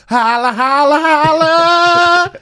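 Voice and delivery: rising high sing-song holla; holla call. Pretend. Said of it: "I'll do FaceTime with my therapist and go".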